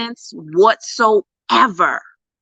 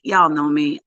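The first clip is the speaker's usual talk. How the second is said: ordinary talk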